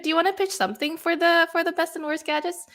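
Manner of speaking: high, light pitch for a casual request; imitated friendly ask. Host replays asking a colleague for a pitch, high-voiced request. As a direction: lift the voice into an acted question.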